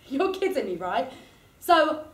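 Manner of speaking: usual speaking voice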